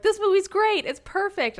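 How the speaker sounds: high-pitched naive enthusiasm; mock gushing. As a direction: imitate a younger self raving. Quoting their younger, adoring self.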